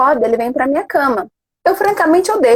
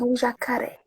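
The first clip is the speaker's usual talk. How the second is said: bem mais baixo, quase em segredo; quiet mock fear; 12 dB quieter than usual. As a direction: repita baixinho, entrando na brincadeira. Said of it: "Falei assim". (mãe repete baixinho para o filho)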